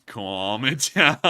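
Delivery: slow, breaking into a laugh. Shown as a demonstration. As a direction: laugh in the middle of it.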